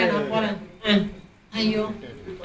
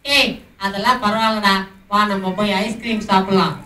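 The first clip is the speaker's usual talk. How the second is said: usual speaking voice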